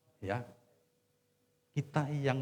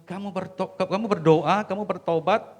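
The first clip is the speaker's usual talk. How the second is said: higher, louder pleading list; urging; 8 semitones higher, 11 dB louder than usual. Retold or imitated. Pastor urging the wife to pray and repent, louder. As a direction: press each step on her.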